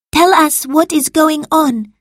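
high, loud order to speak; stern demand. A narrator's reading. Marcus interrogating Salvius, stern demand. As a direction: demand an explanation.

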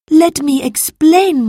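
high, loud appeal; upset pleading. A narrator's reading. Salvius begging his master to listen, upset. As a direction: beg for a chance to explain. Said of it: "He looked very upset".

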